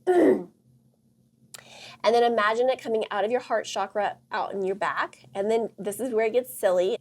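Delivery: normal talking voice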